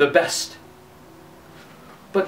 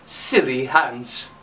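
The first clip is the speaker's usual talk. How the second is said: low, slow Silly Hans; drawn-out nickname; 7 semitones lower, 4 dB quieter, much slower than usual. Acted. Father and brothers mock him as Silly Hans, slow and low.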